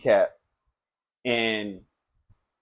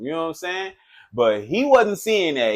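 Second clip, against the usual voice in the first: ordinary talk, nothing marked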